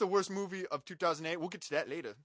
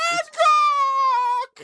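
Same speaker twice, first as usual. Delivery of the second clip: very high, very loud held yell of the name; screaming the title; 17 dB louder than usual. Acted. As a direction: belt it out like a hero's battle cry. Mock movie shout.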